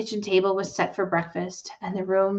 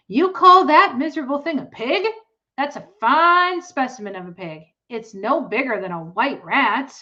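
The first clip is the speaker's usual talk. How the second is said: put-on scornful boy; 6 semitones higher, 7 dB louder than usual. Boy mocks the runt.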